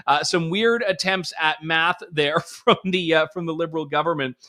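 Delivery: ordinary talk, nothing marked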